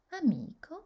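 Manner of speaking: amico with the stress on MI; stress on second-last syllable. Shown as a demonstration. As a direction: lean on MI in amico. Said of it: "sur l'avant-dernière syllabe". (accent tonique sur « mi » dans amico)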